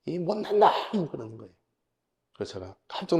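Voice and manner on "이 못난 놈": drawn-out, higher mock scolding; retold angry outburst. Pastor father scolds his son over the phone, mock angry outburst. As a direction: imitate a father's outburst without full volume. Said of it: "소리를 버럭 지르시는 거예요".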